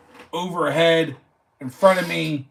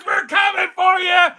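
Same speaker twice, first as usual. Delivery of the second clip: hype shout; 9 semitones higher than usual. Acted. Eagles fan taunting the Packers, high shout. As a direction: yell it at the rival team. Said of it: "You ain't got this energy".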